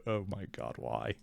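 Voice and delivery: normal talking voice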